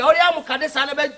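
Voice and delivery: ordinary delivery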